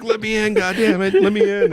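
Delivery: high, loud mock yelling; locked out on a hot balcony, yelling high and loud; yelling to be let in. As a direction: pound on the glass and yell to be let in. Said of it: "he's like".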